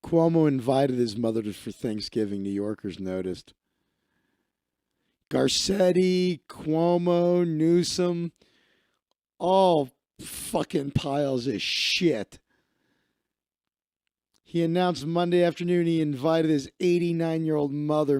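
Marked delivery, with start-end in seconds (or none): 5.3-12.4 slow, drawn-out contempt; disgusted rant. Real, not acted. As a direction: spit out each name slowly, disgusted. Ranting at governors he despises.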